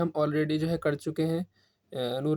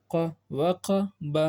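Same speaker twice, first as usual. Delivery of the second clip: drawn-out, slow recitation; teacher reading the word slowly; slow fluent reading; much slower than usual. Shown as a demonstration. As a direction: stretch each sound out slowly for the pupils. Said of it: "اب روا میں کیسے پڑھیں گے".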